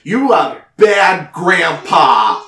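put-on giant child scolding. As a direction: scold like a bossy giant little girl. Giant girl scolds grandpa.

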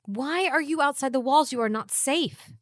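loud, high, urgent demand; alarmed scolding. Acted. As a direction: confront them in exasperated alarm. Acted quarrel, scolding companion for danger.

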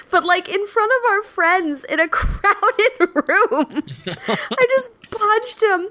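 high, slow, through laughter; laughing. Real, not acted. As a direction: laugh while confessing. Laughing retelling the punch.

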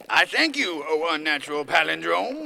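loud theatrical gratitude; hammed-up courtly flourish; grandiose thanks. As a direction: hail the master with florid deference. Servant thanks tyrant grandly.

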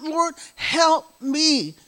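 high, very slow, pleading; crying out. Retold or imitated. Crying out to the Lord for help, slow and high. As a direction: stretch the plea out to the Lord. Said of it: "I'm crying out".

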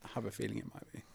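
unvoiced whispered aside. Real, not acted. Aside to co-host.

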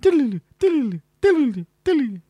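high, falling trilled diddly sounds; imitated flashback effect. Host imitating Wayne's World flashback, sing-song trill. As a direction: trill it high and slide down each time. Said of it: "when they do in Wayne's World".

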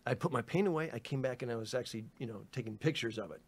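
ordinary talk, nothing marked

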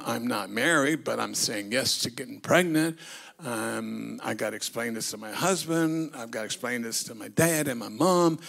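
low and quiet, musing to herself; quietly voiced fretting Mary; Mary's worried thoughts. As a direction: murmur the worries under her breath.

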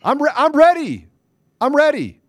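loud, high, fired-up declaration; defiant shout. Real, not acted. Daring the rival to come at him, loud and high. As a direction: call it out like a fighter stepping up.

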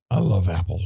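dry, heavy sarcasm on loving Apple; sarcastic. Real, not acted. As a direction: say it so it clearly means the opposite. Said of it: "I say that very sarcastically".

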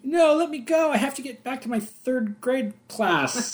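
put-on high, pleading kid voice; whining child. Kid begging to get back to class, pleading.

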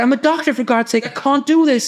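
hammed-up Belfast wail of protest; tearful Belfast impression. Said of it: "He was from Belfast. It's a good impression".

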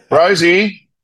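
dog owner calling out, pitch jumping up. Real, not acted.

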